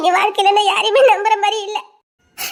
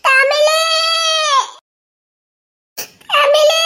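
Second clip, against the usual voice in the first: high, loud drawn-out name call; 6 semitones higher, 4 dB louder, much slower than usual. Acted. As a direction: holler the name, stretching the last vowel. Calling out to a friend.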